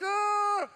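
long, high, loud mimicked scream of fright; hammed-up frightened shriek; scared gasp. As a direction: imitate her scared cry, loud and high. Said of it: "She's so scared".

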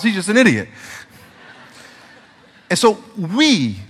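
ordinary delivery

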